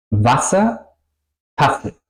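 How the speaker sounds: short A before a long S in "Wasser, Tasse"; vocal corta. Shown as a demonstration. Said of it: "suena corta". (a corta y ese larga en "Wasser, Tasse")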